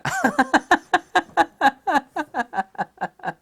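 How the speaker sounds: drawn-out squealing laughter; long high laugh. Real, not acted. Remembering the insult, long high laughter.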